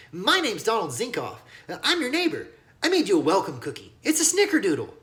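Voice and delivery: put-on high, keen child voice; a friendly kid offering his cookie; little boy proudly presenting his cookie; eager little boy. A narrator's reading. Donald introduces himself, child voice.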